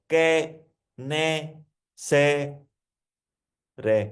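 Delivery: "कै, ने, से, रे" said as four separate syllables with pauses between. Shown as a demonstration. Coach showing listeners how to split the word, syllable by syllable. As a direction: break the word into its syllables, one at a time. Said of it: "उसको अलग अलग करके पढ़ रहे हो आप".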